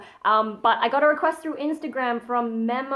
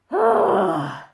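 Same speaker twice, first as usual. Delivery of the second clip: louder airy annoyed sigh; 2 semitones lower, 5 dB louder than usual. Shown as a demonstration. Teaching the false chord sigh, annoyed and airy. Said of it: "like you're annoyed with something".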